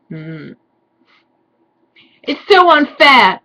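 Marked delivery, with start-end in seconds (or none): 2.2-3.4 high, loud melodramatic whine; mock-dramatic complaint, higher and louder; hammed-up whiny girl. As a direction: overplay the tragic goth heroine. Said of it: "I yielded".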